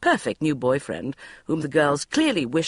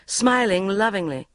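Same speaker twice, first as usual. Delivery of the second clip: plain reading voice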